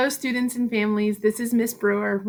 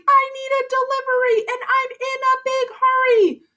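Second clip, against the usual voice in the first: hollering. Acted. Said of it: "he hollered to Murray".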